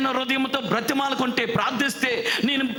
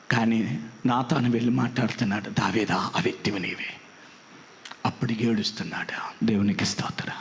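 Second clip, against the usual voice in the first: whisper; 12 semitones lower than usual. Acted. Hushed retelling of Nathan's rebuke.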